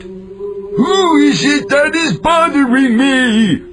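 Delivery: slow, loud, high hammed-up ogre holler. Ogre bellows at the knocking cat, slow and loud. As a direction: roar at the intruder at the door. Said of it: "The ogre hollered".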